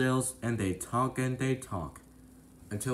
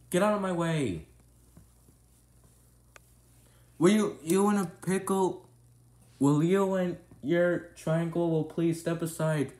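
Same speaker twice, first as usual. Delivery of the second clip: pushy doctor; 6 semitones higher, 3 dB louder than usual. Acted. Doctor pushes through rudely.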